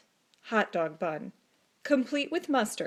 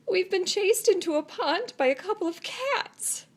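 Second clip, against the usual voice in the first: higher, whiny groan; moaning; 8 semitones higher than usual. A narrator's reading. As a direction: moan in shame. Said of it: "they moaned".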